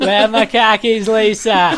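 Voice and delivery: put-on robot nagging his wife in a thick yinzer accent; mock Pittsburgh accent calling for Lisa. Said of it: "speak with, like, the accent".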